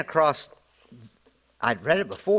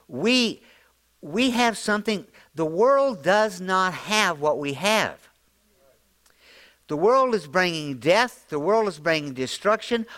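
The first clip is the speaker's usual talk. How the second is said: high, building sermon climax; impassioned preaching; 8 semitones higher than usual. Acted. Pastor urging the congregation, full-force preaching. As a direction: drive each "we have" line higher.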